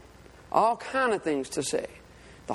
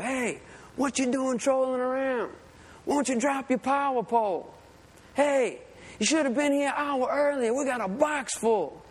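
higher-pitched mock scolding, no real shouting; retold cussing-out; 6 semitones higher than usual. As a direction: needle them like an irate rival boater. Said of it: "He's saying all kind of cussing words".

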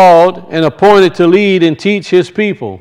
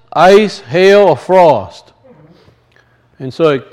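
normal talking voice

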